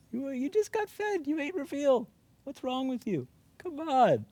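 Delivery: high exasperated pet scolding; mock scolding a cat. Real, not acted. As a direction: nag the crying cat.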